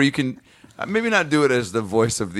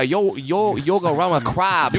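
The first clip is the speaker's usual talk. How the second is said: mock accent; 8 semitones higher, slower than usual. Mocking the restaurant owner.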